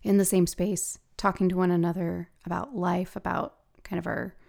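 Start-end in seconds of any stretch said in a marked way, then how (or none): none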